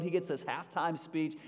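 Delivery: ordinary talk, nothing marked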